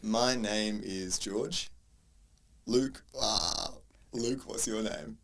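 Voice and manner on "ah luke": ordinary delivery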